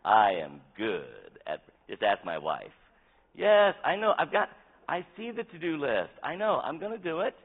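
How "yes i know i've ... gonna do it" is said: high, loud mock protest; defensive husband. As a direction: insist in a raised pitch, fending off a nagging wife. Himself answering his nagging wife.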